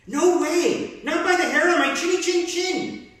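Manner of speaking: high and loud, a little pig refusing; crying out. Acted. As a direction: call back high and loud. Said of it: "cried the second little pig".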